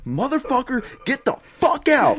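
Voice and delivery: high, loud yell; retold yell. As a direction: shout him off the field. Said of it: "And I'm yelling at him".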